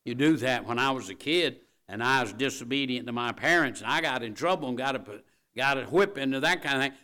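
loud preacher delivery; preaching. Acted. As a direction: keep the preaching going strong. Preacher at full force.

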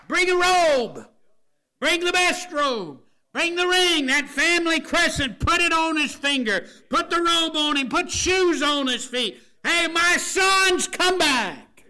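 high, drawn-out shouts to the servants; exultant father. Acted. Father calling servants as his son returns, exultant shouting. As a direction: holler orders at full voice, overjoyed. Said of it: "His dad said".

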